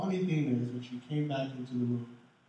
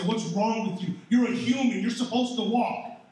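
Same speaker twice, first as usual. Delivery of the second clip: raised, louder mock reproach; mock scolding; 6 semitones higher, 6 dB louder than usual. Pastor mocking someone who scolds a falling baby, loud mock reproach.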